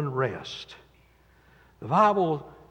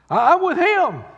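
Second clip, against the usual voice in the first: high, loud boast; proud high shout of loyalty; 9 dB louder than usual. Retold or imitated. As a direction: belt out Peter's boast. Peter's boast retold louder.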